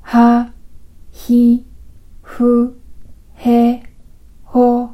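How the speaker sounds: ハ、ヒ、フ、ヘ、ホ said slowly, one at a time, with a pause after each; slow syllable demo. Shown as a demonstration. Teacher slowly models ハ ヒ フ ヘ ホ for learners to repeat. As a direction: say ハ ヒ フ ヘ ホ slowly and separately so each consonant is heard clearly. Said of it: "ゆっくりと、ハヒフヘホと発音してみます".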